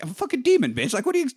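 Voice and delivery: high mock demon retort; sassy demon. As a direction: sass back like an annoyed demon. Demon talking back.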